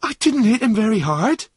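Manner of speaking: high, loud protest; distressed protest. Acted. Runaway defending himself to the driver, distressed protest.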